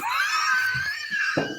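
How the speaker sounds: high, shrill burst of laughter; shrieking laugh. Real, not acted. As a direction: explode into a screaming laugh. Cracks up at a joke.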